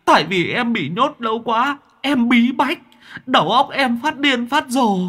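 very high, strange whining demon voice, begging; wailing demon. Acted. Demon begging its master to stop beating it, high wailing. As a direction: howl and grovel like a beaten imp. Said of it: "Con quỷ mõm đau đớn rú lên".

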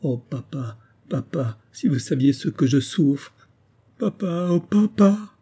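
put-on a girl sobbing for her father, climbing into a cry; sobbing plea. A narrator's reading. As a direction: fille en sanglots qui appelle son père. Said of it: "qui sanglotait et répétait sans cesse".

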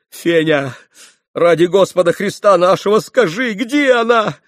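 loud, high-pitched imploring; desperate pleading. A narrator's reading. Mitya fallen at Fenya's feet begging, desperate plea. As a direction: beg as if on your knees.

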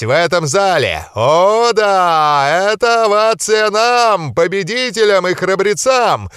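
громкий, протяжный анонс на весь зал; ведущий боксёрского ринга. A narrator's reading. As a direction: raise the crowd like a ring announcer. Referee keeps hyping crowd. (ведущий на ринге заводит толпу, громко и протяжно)

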